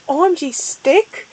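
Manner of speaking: plain reading voice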